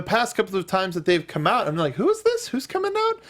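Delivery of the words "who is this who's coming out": high confused question. Retold or imitated. Confused entrance reaction, very high.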